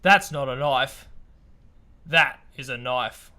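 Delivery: slow, low mock Australian accent; lightly done Aussie tough guy. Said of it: "in our best Australian accent".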